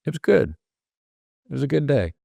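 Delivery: unhurried, thoughtful reply with room between phrases; considered answer. Shown as a demonstration. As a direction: pause first, then high and falling, in short separate phrases. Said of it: "you can just hear the difference in which one listened".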